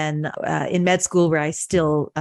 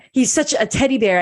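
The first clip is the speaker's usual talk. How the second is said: higher and louder, quick, voice welling up; getting emotional; 6 semitones higher, 4 dB louder, faster than usual. Real, not acted. Guest remembering her friend's dad, voice rising with emotion. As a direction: emotion spilling through the words. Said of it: "I'm getting emotional".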